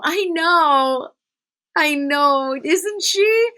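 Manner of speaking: high, loud and bubbly agreement; proud excited gush. Retold or imitated. Mom gushing back at strangers who praise her daughter, high and excited. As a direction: squeal it like a delighted proud mom. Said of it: "I always go".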